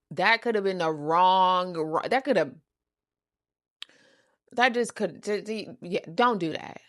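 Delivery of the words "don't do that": ordinary delivery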